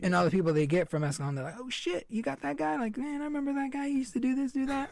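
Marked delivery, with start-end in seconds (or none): 1.6-4.9 high-pitched mock fan recognition; put-on high voice of impressed listeners; imitating amazed listeners. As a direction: do a high, softer voice for fans reacting. Voicing excited listeners from hometown.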